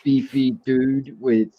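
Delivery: put-on British accent, halting as you search for words; mock-British presenter, drawing out the description. Shown as a demonstration. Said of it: "I can definitely do a British accent".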